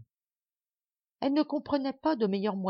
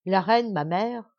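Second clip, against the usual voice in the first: slow, louder words rising at the end; stately slow; 2 semitones higher, 6 dB louder, slower than usual. Acted. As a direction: draw out the queen's name gravely. (lent et solennel)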